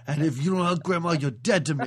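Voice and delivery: loud, fast angry threat; angry scolding. Retold or imitated. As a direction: snap out the threat. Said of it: "She's like".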